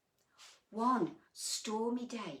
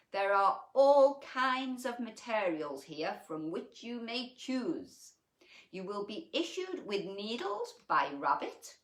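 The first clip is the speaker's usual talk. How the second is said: louder, higher pompous proclamation; pompous elephant; 4 semitones higher, 6 dB louder than usual. A narrator's reading. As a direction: announce it like a self-important elephant. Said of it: "pompously and addressed the gathering".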